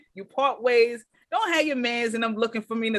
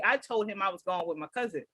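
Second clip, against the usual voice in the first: ordinary talk, nothing marked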